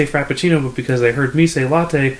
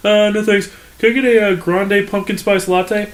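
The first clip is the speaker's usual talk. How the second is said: mock customer voice, pitched up; oblivious customer; 8 semitones higher than usual. Customer orders at the drive-thru speaker, mock oblivious voice. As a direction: imitate a clueless customer ordering, higher than usual.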